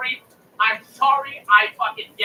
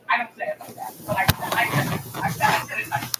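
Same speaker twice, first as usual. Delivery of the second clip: normal talking voice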